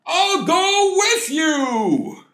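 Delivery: very high, long-drawn mock holler; shouting. Father eager to join his son, shouting. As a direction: holler it out, stretching every word. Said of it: "shouted his father".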